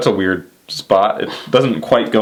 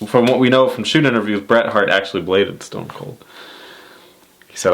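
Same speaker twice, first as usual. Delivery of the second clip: normal talking voice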